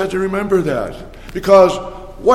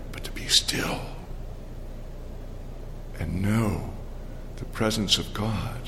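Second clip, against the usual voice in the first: whispering; 9 semitones lower, 11 dB quieter than usual. Real, not acted. Stillness before God, hushed.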